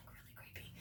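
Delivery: a mock-secretive whisper about him; whispered aside. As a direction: drop to a sudden whisper. Whispered aside about the creepy guy.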